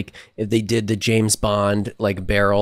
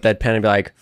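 ordinary talk

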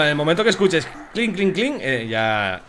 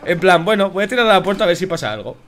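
excited; 6 semitones higher, 6 dB louder than usual. Real, not acted. Explaining the throw heatedly.

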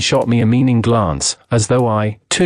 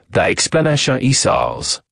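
put-on thick French accent, courteous and pressing; French detective, eager. Detective questions madame about her daughter's fiancé, French-accented. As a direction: play a polite, excited French sleuth.

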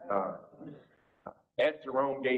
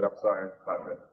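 ordinary talk

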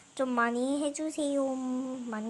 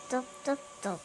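ordinary talk

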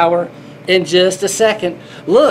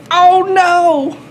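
mock alarm; 10 semitones higher, 4 dB louder than usual. Kitty spills water, mock alarm.

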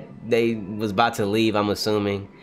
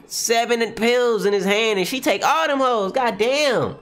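shocked, high-pitched disbelief; 11 semitones higher than usual. Real, not acted. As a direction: voice shoots up in astonishment. Shocked reaction to pills.

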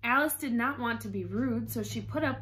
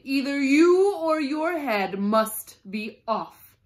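shouting, then easing; 10 dB louder, slower than usual. A narrator's reading. The Queen gives the Duchess an ultimatum, shouting. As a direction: shout the ultimatum, drawing out each word. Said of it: "shouted the Queen".

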